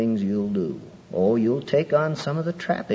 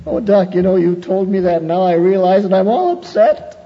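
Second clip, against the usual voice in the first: higher, louder mock whine; mock upset congregant; 7 semitones higher, 5 dB louder than usual. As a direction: parody a fretful churchgoer. Mocking congregant's complaint.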